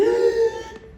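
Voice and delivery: sharp high gasp of surprise; a loud, high astonished gasp. Acted. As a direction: gasp as if amazed by the picture. Reader gasps at the picture.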